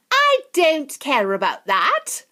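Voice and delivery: high, slow loud spoilt shout. Acted. Veruca refusing her mother, high slow loud shout. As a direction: stamp it out, defiant. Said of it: "shouted Veruca".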